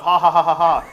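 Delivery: big, high, fake ha-ha laugh; theatrical, spelled-out laughter; loud mock laugh. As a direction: belt out a hammed-up read-aloud laugh. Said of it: "could you do a dramatic reading here?".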